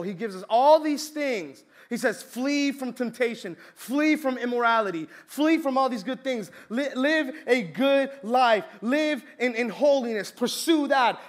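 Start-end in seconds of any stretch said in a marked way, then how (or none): 2.2-11.2 slow, higher, emphatic preaching; earnest preaching. Acted. Preacher urging the congregation to flee temptation, earnest. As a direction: press each command on the congregation. Preacher voicing God's commands.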